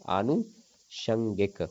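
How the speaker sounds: आनुषंगिक said very slowly, long first आ stretched; slow careful word. Shown as a demonstration. Teacher to exam students, slowly stressing the long आ. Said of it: "तो क्या हो जाएगा".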